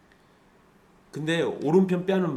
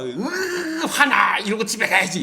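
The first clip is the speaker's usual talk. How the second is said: loud, high, hammed-up fuming; play-acted loud grumbling; mock angry outburst; 8 semitones higher, 5 dB louder than usual. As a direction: act out huffy anger after a slap. Acting out a slapped person's reaction.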